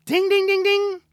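a high, loud mock bell going ding ding; light bulb ding. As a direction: ring out like a bell in his head when the idea hits. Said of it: "he was like".

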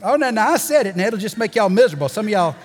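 quick, loud teasing jab at the crowd; playful teasing. Real, not acted. Preacher teasing his congregation about money, laughing loud. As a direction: needle the congregation with a grin.

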